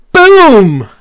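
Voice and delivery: loud, high booming shout; big storybook shout, drawn out. A narrator's reading. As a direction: burst out like a thunderclap. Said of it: "I shouted".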